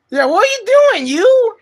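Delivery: high-pitched, sing-song teasing; playful pet talk. Pretend. Streamer to the cat, playful high pet talk.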